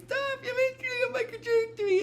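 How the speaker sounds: very high acted squeal; put-on high-pitched ship voice; tickled protest. Ship being tickled, in retold scene.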